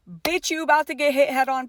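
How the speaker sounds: voicing a blaring horn as words; urgent warning. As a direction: warn them like a truck laying on its horn. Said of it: "It was a warning".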